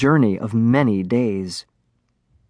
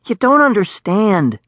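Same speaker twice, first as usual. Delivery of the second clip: higher-pitched protest; urgent pleading; 8 semitones higher than usual. A narrator's reading. Darek to the dragonling in danger, urgent pleading. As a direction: press the point, voice lifted.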